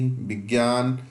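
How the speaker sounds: ordinary talk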